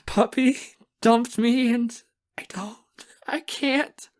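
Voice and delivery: high, gasping sobs; crying. A narrator's reading. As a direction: choke it out in broken, tearful bursts. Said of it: "at the sound of me crying".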